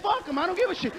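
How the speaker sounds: ordinary talk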